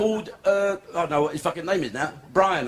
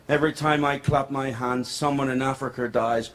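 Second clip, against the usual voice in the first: lower, grave preaching tone; 5 semitones lower than usual. Retold or imitated. Comic mocking [name]'s charity speech, lower and solemn. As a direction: intone it like a sanctimonious sermon. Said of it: "He goes on saying".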